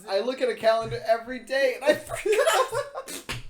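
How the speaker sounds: laughing. Real, not acted. Laughing at own forgetting.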